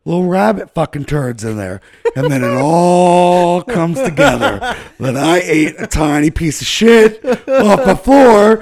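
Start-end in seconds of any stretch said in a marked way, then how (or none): 2.2-8.6 slow, laughing through the words; can't stop laughing as it dawns on him; laughing while telling. Real, not acted. Telling friends he ate poop, laughing.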